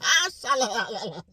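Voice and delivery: mock tongues, high, loud burst; speaking in tongues. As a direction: ham up a sudden outburst of tongues. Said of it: "he bust out in tongues".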